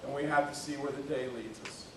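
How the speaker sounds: ordinary talk, nothing marked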